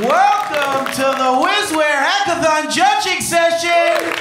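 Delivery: hyped emcee calling the hall to order; announcer shout. Acted. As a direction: boom it out to the crowd. Host shouting a welcome.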